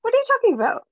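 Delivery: high pitched indignant squeal; mock outrage. Real, not acted. Friend squealing at being caught sharing, high. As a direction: protest in fake shock. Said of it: "mock indignation".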